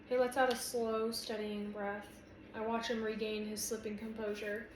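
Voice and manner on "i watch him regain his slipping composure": ordinary delivery, nothing marked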